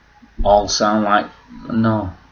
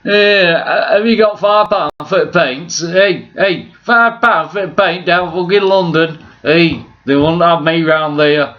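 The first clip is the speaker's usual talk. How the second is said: high, hammed-up Yorkshire accent; a lightly put-on Yorkshire accent, teasing; taking off a tight-fisted Yorkshireman in a Yorkshire accent; mock Yorkshire accent; 9 semitones higher, 3 dB louder than usual. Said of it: "Or however they speak in fucking Yorkshire".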